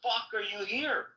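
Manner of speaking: a touch of high mock disbelief; half-hearted stunned outburst; incredulous. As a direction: blurt it in shock. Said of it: "I said".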